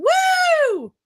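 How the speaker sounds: high shouted woo; sarcastic woo. Acted. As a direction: cheer it up high and loud. Mock celebration of death.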